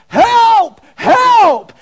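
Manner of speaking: very high, loud cry for help; man in gorilla suit shouting under the lion; panicked yell. Acted. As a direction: scream for your life. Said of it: "So he yelled out".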